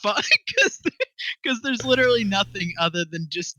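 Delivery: high, loud burst through real laughter; helpless laughing outburst. Real, not acted. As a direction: crack up as you announce the winner. Cracks up announcing the winner.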